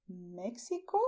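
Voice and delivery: Mexico with x as ks, rising at the end; ks sound. Shown as a demonstration. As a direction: say "Mek-si-ko" for Mexico. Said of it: "do not say this".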